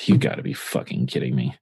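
low, sighing disbelief; sigh, then sink low in defeat; deflated. Retold or imitated. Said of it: "I just literally just deflate into".

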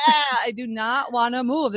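groaned-out playful refusal; mock protest. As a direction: whine against moving, half-joking. Mock protest against moving.

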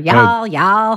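a light, half-hearted Southern drawl on Y'all, y'all; Southern accent attempt. Teasing the podcast partner, a light mock Southern accent. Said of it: "[name]'s attempt at a Southern accent".

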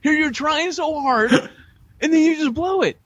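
very high, squeezed out through laughter; helpless laughing. Real, not acted. Teasing a co-host for blowing his careful answer, laughing hard. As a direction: crack up while trying to talk. Cracking up at co-host's food slip.

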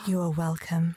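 solemn blessing voice. Acted. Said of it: "cups your cheek and says".

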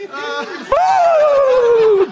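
high, loud whoop with a laugh; triumphant yelp of joy; excited whoop. Real, not acted. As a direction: whoop like you just hit the bird. Shot fires on turkey.

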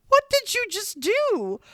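high, loud, slow incredulous cry; stretched-out shrieking what-did-you-do; appalled disbelief. Retold or imitated. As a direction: drag it out in horrified shock. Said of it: "I'm like".

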